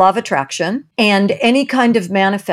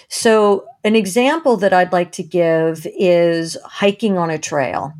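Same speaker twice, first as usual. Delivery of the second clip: normal talking voice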